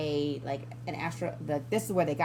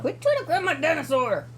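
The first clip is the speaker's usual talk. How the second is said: scolding; 11 semitones higher, 5 dB louder than usual. Real, not acted. Scolding toddler mid-recording.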